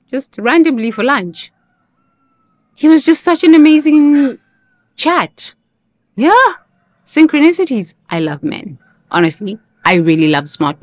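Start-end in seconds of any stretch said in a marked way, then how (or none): 2.7-7.9 high, giddy and excited; gushing. Real, not acted. Telling viewers about a lunch date, high and gushing. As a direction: gush about him, voice up high.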